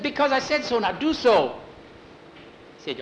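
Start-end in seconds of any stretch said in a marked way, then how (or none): 0.0-1.6 high, loud, impatient order; cross. Acted. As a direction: snap it as a firm high-pitched command. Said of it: "get so mad at me".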